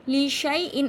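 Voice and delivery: "लिशाई इन" with shai run together, no long aa; silent alif. Shown as a demonstration. Teacher showing the correct reading, "लिशाई इन" without the alif. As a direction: recite "लिशाई इन" loudly, skipping the alif. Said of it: "लेकिन अलिफ को नहीं पढ़ा जाएगा".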